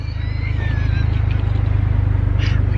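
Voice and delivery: big helpless burst of laughter. Real, not acted. Laughing at joke.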